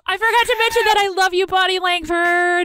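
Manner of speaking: gasp, then high, loud adoring cry; excited, high-pitched declaration of love; gushing fan outburst. Real, not acted. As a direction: blurt it out fast, then stretch the name.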